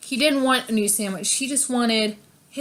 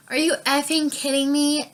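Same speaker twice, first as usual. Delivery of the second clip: rude customer; 5 semitones higher than usual. Retold or imitated. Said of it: "she's like".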